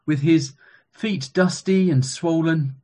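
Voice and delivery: ordinary talk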